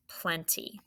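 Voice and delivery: soft whispered plenty; whispered word. Shown as a demonstration. Teacher whispers the spelled word.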